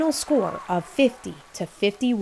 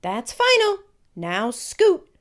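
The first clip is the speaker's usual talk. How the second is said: stern mom; 7 semitones higher, 4 dB louder, slower than usual. Acted. Mother ends the argument.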